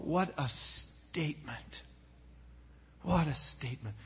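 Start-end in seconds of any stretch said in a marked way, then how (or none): 0.0-1.8 hushed, awed whisper. Real, not acted. Preacher marveling to the congregation, hushed whisper. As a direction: breathe it out in wonder, almost silent. Awed repeat after the quote.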